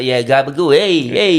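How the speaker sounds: lightly played Jersey mob accent on "gabagool", lifting sharply on "Hey, hey"; a quick, playful Italian-American wiseguy bit; Sopranos impression. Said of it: "I never worked on my Sopranos".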